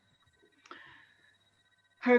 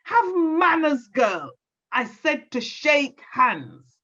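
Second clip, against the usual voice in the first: high sharp "What?", then a scolding granny voice; vexed granny; 6 semitones higher than usual. Acted. Granny angry that Lorna won't shake hands, high and scolding. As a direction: snap at the girl like an angry granny. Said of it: "Her grandma got angry. She got vexed".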